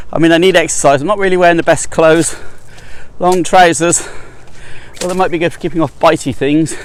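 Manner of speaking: talking while winded, puffing; breathless from climbing uphill; out of breath. Real, not acted. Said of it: "huffing and puffing".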